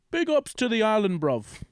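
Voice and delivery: a Brit trying on Jamaican slang; British-Jamaican mix. Retold or imitated. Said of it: "a British person, like, going to Jamaica".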